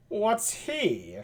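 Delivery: soft, lightly nasal character voice; a hint of nasal put-on voice; nasally Benedick. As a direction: ask it softly through the nose. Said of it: "kind of a nasally sound".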